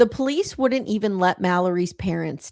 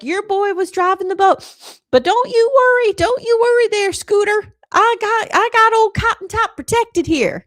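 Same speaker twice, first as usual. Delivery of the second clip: high, loud folksy caricature; hammed-up good-old-boy phone reassurance, pitched way up; Alec; 13 semitones higher, 5 dB louder than usual. Alec calls a boy's dad about the accident, hammed-up reassurance. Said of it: "And he's like".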